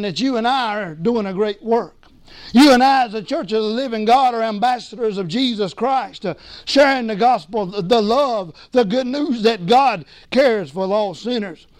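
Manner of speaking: ordinary delivery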